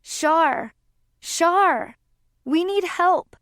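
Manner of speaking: high, loud cry for help; sob-strained shouting. A narrator's reading. Laurel calling Shar for help in the woods, sob-strained shout. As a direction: call out desperately, pitched well up. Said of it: "began shouting in a sob-strained voice".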